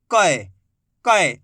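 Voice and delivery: "क" as a punched, falling "kai"; forceful "ai" syllable. Shown as a demonstration. Teacher drilling the matra sound, with force. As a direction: press on each "क", gliding "ka-ey". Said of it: "थोड़ा जोर लगाएंगे इसको उच्चारण में".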